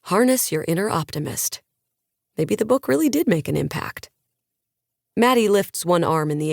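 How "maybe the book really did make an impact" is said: ordinary delivery, nothing marked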